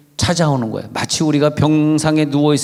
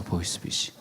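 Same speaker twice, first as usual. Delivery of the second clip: whispered aside; 8 semitones lower, 14 dB quieter than usual. Real, not acted. Aside about novice scammer.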